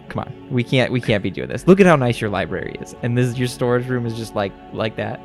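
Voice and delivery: ordinary talk, nothing marked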